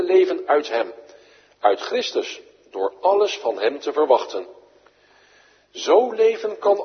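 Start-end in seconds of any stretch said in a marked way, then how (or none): none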